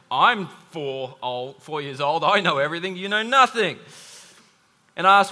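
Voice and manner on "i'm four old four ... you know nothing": put-on smug child know-it-all. Pastor voicing his smug four-year-old daughter, lightly hammed-up. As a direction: play the eye-rolling daughter who knows everything, a touch smug. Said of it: "that rolled eyes mean".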